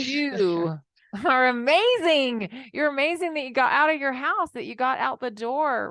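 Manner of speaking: loud, gushing praise for a stranger; enthusiastic praise. Retold or imitated. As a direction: cheer them on loudly. Cheering on parents in Costco.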